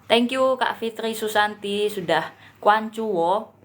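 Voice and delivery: normal talking voice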